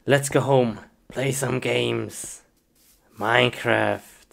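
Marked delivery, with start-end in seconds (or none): none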